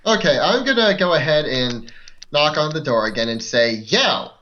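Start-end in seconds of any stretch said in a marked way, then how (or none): none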